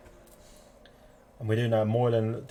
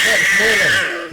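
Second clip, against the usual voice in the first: higher, louder, slow announcement; hyped name call; 7 semitones higher, 8 dB louder, slower than usual. Acted. Commentator hyping the driver's name, loud and slow.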